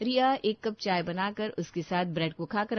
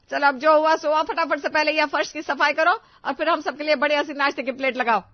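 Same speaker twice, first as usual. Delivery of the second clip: higher, much louder dramatic complaint to God; angry mother-in-law; 5 semitones higher, 8 dB louder than usual. Acted. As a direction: wail at heaven, then bark orders. Mother-in-law berates the bride.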